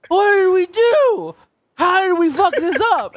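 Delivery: high, loud panicked server; a shrill, drawn-out server panicking over a botched order. Retold or imitated. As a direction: shriek it high and slow, like the whole order is ruined.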